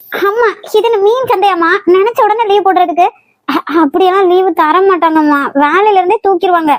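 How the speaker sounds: ordinary delivery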